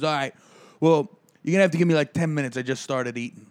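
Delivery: a gruff mechanic who can't be bothered, done fast; mechanic's brush-off. Retold or imitated. Retelling how the mechanic told him to wait, gruff and brisk. Mechanic voiced in retold story.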